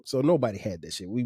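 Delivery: ordinary talk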